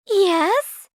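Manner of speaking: high, loud hopeful question; excited, expectant squeak; eager cry. A narrator's reading. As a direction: burst out with sudden hope. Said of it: "Cinderella cried".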